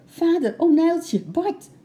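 high, loud urgent shout; excited child calling out. A narrator's reading. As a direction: shout for them, bursting with news. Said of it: "riep ze opgewonden".

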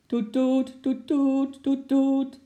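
high, loud repeated horn toots; car horn imitation. Horn sounding outside the house, high loud toots. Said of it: "klonk het buiten".

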